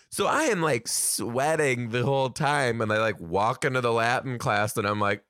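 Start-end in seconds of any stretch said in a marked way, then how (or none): none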